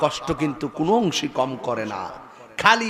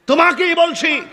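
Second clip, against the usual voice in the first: pointed shout; 11 semitones higher, 4 dB louder than usual. Acted. Pointing at the youth.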